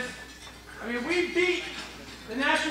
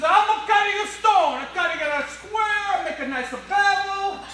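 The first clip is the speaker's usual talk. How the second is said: high, drawn-out worker's voice; second stone cutter; 6 semitones higher, slower than usual. Acted. Stone cutter explaining his job to a nosy passer-by, high drawl. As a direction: stretch it out slowly, pitched well up.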